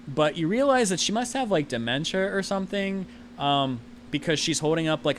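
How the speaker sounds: ordinary talk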